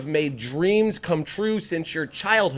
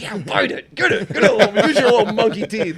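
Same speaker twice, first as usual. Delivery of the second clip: high laughing taunt to the monkey; Ace Ventura quote; 7 semitones higher, 7 dB louder than usual. Retold or imitated. Quoting Ace Ventura daring the monkey, high and laughing. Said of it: "He's like".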